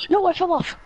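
high yelp of dismay; distressed yelp. Real, not acted. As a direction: cry out as you fall. Falling off again.

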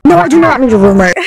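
loud, high mock yell; hammed-up shouted refusal; inner child's outburst. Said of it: "my inner child was like".

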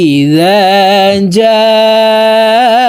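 جاء chanted with a very long held aa; four-alif elongation. Shown as a demonstration. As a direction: stretch the aa in جاء for four beats. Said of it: "এই জিমকে চার আলিফ টেনে পড়ব".

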